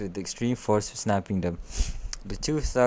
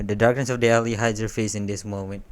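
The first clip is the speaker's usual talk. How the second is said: plain reading voice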